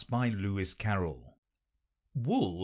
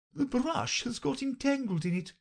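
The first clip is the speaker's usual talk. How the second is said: sighing. A narrator's reading. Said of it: "the Queen said with a sigh".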